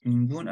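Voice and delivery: "Ninguno" tried out as ningbuno; G and B together. Shown as a demonstration. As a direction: blend a B into the G of "Ninguno". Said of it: "como una G con una B juntas". ("Ninguno" con G y B juntas)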